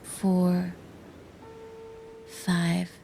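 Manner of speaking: slow, soft count with long pauses; hushed, unhurried breathing-exercise voice; guided breathing count. Shown as a demonstration. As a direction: count the breaths softly, word by spaced word. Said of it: "The breathing process goes like this".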